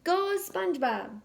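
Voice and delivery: high, slow cheer; drawn-out cheer. Acted. Patrick cheering SpongeBob from the stands, slow and high. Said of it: "Patrick cheered".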